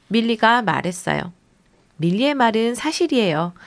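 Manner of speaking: usual speaking voice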